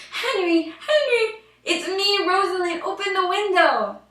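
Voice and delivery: high, loud call up to a window. Acted. Princess calling up to Henry's window at night, high loud call. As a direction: call him loudly from below.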